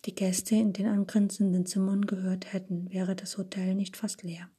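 usual speaking voice

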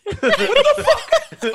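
very high, slow, laughing disbelief; shocked laugh. Real, not acted. Friend reacting to a wild clip, laughing shock. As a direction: squeal it out in shock.

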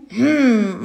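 loud hum with sealed lips; wordless ventriloquist hum; muffled closed-mouth hum. A narrator's reading. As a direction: answer without opening the mouth. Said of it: "machte Primel".

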